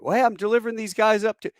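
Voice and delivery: high, fast acted-out objection; flustered protest. Truck driver objects to being held up, flustered. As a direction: protest in a hurry before being cut off.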